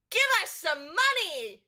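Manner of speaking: put-on high, loud childish demand; petulant kid shouting for money; bratty child demand. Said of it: "She goes".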